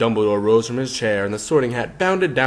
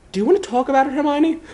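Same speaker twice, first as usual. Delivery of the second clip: put-on high young boy asking through a door; gentle Harry voice; 4 dB louder, faster than usual. A narrator's reading. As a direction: coax a friend to open up.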